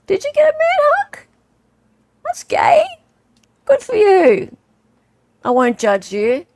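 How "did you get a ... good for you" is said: very high put-on teasing voice; teasing squeal. Streamer teases a viewer about a man hug, high mock-teasing. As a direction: tease in a squeaky, far higher voice.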